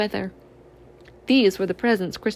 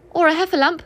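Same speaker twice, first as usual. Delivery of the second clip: higher, a little louder, nervous; a touch of fright at the thought; mild scared little piglet; 7 semitones higher, 4 dB louder than usual. A narrator's reading. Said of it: "he shuddered at the thought".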